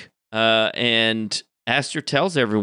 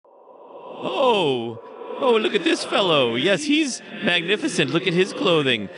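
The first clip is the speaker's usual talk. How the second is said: high-pitched, haughty talking mirror; scolding magic mirror; 9 semitones higher than usual. Acted. Magic mirror criticising an adventurer's outfit, high and scolding. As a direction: gush over the other fellow, then chide, voice pitched up. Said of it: "the magic mirror is telling him about how ridiculous he looks".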